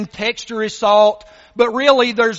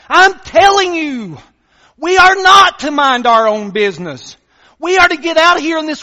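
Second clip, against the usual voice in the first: louder, higher, insisting on not; emphatic preaching; 6 semitones higher, 6 dB louder than usual. Real, not acted. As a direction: press the point hard. Preaching at full force.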